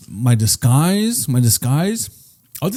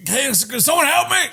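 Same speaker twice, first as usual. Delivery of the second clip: high-pitched pleading put-on voice; hammed-up plea for help; helpless fat-suit character; 11 semitones higher than usual. Man in a wheelchair disguise asking passersby, mock pleading. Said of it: "I'll be like this".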